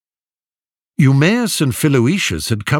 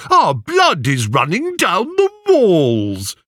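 high, squealing mock alarm; a suitor parodying the prophet; mocking cry; 9 semitones higher than usual. A narrator's reading. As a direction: ham up the seer's panic to mock him. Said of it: "he cried".

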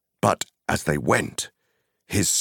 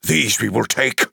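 high, abrupt, commanding wizard; sudden forceful seizing of control; sudden outburst; 9 semitones higher, 5 dB louder than usual. Acted. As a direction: cut in suddenly, pitched high. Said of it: "said Gandalf suddenly".

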